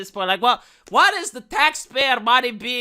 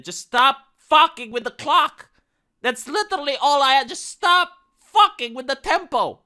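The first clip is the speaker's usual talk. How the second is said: exasperated yell; 6 semitones higher, 5 dB louder than usual. Real, not acted. Vlogger yelling at viewers about changing the clock, exasperated. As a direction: vent the frustration, leaning on fucking.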